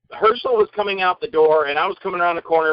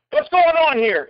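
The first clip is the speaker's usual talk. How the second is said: high-pitched retold challenge; mock outraged demand; 9 semitones higher, slower than usual. Actor butts into director's row, mock outraged. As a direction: act out barging into an argument. Teller acts barging into row.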